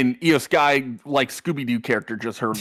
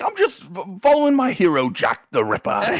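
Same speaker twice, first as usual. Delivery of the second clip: mock British; 7 semitones higher than usual. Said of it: "he's like".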